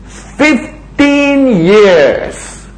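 hugely drawn out and high, falling away at the end; triumphant proclamation. Acted. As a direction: stretch each word out as a grand climax. Climax of God's promise read aloud.

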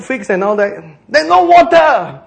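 ordinary delivery

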